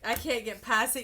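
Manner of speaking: ordinary talk, nothing marked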